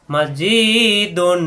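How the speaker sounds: মসজিদুন with the জি drawn long and slow; stretched vowel. Shown as a demonstration. Teacher showing students the long জি in মসজিদুন. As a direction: lengthen the জি in মসজিদুন by one alif. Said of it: "জিকে লম্বা করতে হবে".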